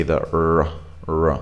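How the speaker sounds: R made from a W lip position; drawn-out R, tongue curled back; R sound demo. Shown as a demonstration. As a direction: hold a rounded R. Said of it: "the position you need for producing that sound".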